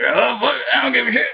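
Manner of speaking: high, giggly imitation of a gruff biker; laughing mock Chibs. Mocking Chibs's reaction to the sheriff, laughing and high. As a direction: squeak it out through laughter, mocking. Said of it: "Chibs is like".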